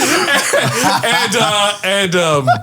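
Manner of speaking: high, giggly fillers; laughing. Real, not acted. Storyteller cracking up at own ending, laughing. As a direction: struggle to resume through laughter.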